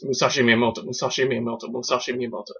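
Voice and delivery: quick run of repeats, no pauses; fast tongue-twister. Shown as a demonstration. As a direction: say the name rapidly, like a tongue-twister. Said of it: "Say that three times fast".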